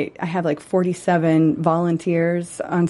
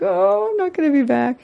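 sad; 8 semitones higher than usual. Retold or imitated. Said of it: "it's kind of sad being here today 'cause I'm like".

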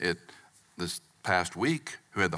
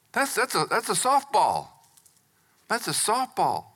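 softball scoff; 10 semitones higher than usual. Acted. Preacher mocking easy question.

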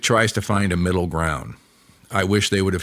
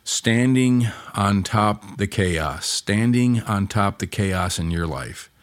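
slow, drawn-out guided picture; slow meditative; slower than usual. Shown as a demonstration. Preacher guiding listeners to picture Jesus, slow. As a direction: paint the image slowly for them. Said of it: "I want you to do is just picture Jesus".

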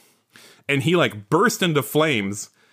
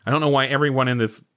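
ordinary delivery

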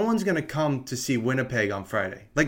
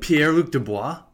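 higher, louder sarcastic question; 4 semitones higher, 5 dB louder than usual. Real, not acted. Co-host jokingly suggests a player to the others, sarcastic. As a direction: toss the name out as a sarcastic question. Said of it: "That was sarcasm".

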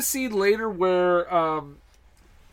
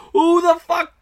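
suddenly loud and high, fed up; exasperated outburst; 11 semitones higher, 7 dB louder than usual. Real, not acted. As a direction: blurt it out in disbelief. Exasperated rant about movie dialogue.

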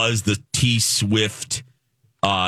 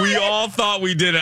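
ordinary delivery

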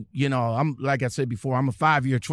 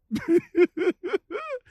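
laugh. Real, not acted. Laughs after his rant.

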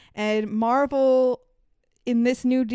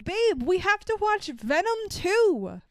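slow, much higher pleading with a long "Babe"; drawn-out mock husband whine; 9 semitones higher than usual. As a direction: stretch the begging out like the husband. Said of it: "and says".

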